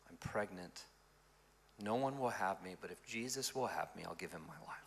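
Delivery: a touch of tears, hushed and low; retold tearful confession. Pastor voicing the pregnant girl's tearful confession, hushed. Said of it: "started to cry".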